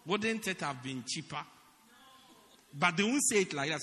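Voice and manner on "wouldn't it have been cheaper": ordinary delivery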